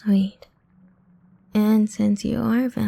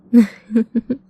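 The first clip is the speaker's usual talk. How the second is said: wicked little laugh; 7 dB louder than usual. Acted. Vampire laughs after feeding.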